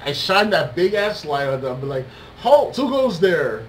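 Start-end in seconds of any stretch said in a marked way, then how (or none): 2.4-3.6 loud, slow sentry call; playful cop halting a stranger; mock challenge. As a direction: call it out high and drawn-out like a guard. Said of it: "like cops would".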